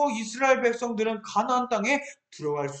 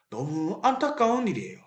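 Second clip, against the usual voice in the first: ordinary talk, nothing marked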